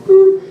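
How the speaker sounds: mock machine beep, high and loud. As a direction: squeak out the voicemail beep. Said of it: "voicemail beep imitation".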